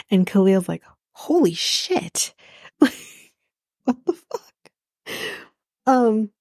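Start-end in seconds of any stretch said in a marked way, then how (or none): 1.1-4.4 higher, slow, drawn-out disbelief; imitating a friend's jaw-drop reaction; stunned holy shit. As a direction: let it hang in shock, stretched long. Khalil reacting to the breakup.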